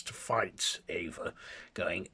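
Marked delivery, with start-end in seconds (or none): none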